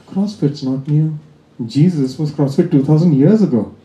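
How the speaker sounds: ordinary delivery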